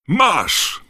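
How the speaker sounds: loud, high, joyful shout; delighted yell. A narrator's reading. Ron handing Harry gold coins, delighted yell. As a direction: yell it with sudden glee. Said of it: "wrzasnął uradowany Ron".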